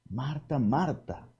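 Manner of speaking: "Marta, Marta" said very softly and lovingly; a soft, caressing "Marta, Marta"; tender call. Shown as a demonstration. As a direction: call her name with tenderness. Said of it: "así con aquel amor, con aquella ternura".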